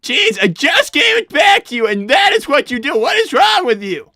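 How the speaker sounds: loud, high frustrated yell; real exasperated outburst; exasperated shout. Real, not acted. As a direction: yell at him for wrecking it. Friend ruins game after handoff.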